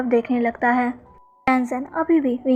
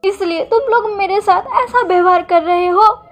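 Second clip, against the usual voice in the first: high, louder crying reproach; tearful complaint; 5 semitones higher, 4 dB louder than usual. Acted. Wing to husband and son, crying that they always make him cry, high tearful wail. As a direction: wail it through tears, pitched up. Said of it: "wing रोते हुए लैंजन को देखता है".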